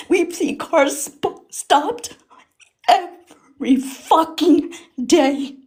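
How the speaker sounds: slow and high, hammering each word in distress; upset emphasis. Real, not acted. Emotional testimony about traffic stops.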